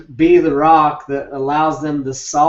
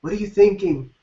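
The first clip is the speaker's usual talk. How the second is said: imitating a nagging partner. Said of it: "You ask them".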